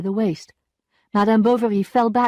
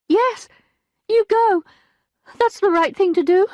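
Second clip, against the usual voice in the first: higher, louder insistent maid's voice; urging maid; 13 semitones higher, 6 dB louder than usual. A narrator's reading. Maid urging her mistress to see the lawyer. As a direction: push her to go.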